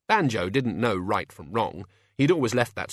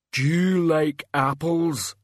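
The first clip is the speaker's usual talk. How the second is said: slow, drawn-out dim giant voice; ponderous simpleton's question; rumbling Banjo; 6 semitones higher, 4 dB louder, slower than usual. A narrator's reading. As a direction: say it slowly and heavily, like a simple brute. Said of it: "Banjo rumbled".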